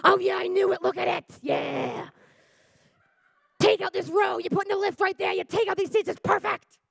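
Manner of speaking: high, eager planning over the van. Retold or imitated. Moms inside the van planning a wheelchair lift, excited. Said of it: "they freak out more".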